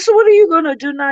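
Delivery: exasperated question. Retold or imitated. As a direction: fire it off in disbelief.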